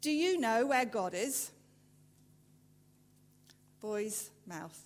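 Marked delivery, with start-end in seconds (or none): 0.0-1.5 loud, booming preacher's question; a big preacher grilling a small boy; stern preacher. A narrator's reading. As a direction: demand it like a huge stern preacher. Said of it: "asked him sternly".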